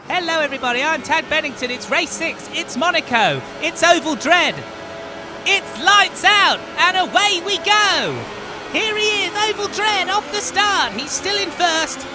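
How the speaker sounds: high, loud race call; motorsport commentator. Acted. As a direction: call the start like live TV.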